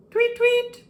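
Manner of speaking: very high, loud whistle blasts; two sharp whistle tweets. A narrator's reading. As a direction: imitate a shrill lifeguard whistle. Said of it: "He heard the loud whistle".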